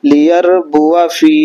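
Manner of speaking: লিয়ারবুয়া ফি drawn out very slowly, alif not read; slow teaching recitation of লিয়ারবুয়া ফি; Quranic reading demo. Shown as a demonstration. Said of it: "উচ্চারণ কি হলো?". (ধীরে ধীরে লিয়ারবুয়া ফি উচ্চারণ)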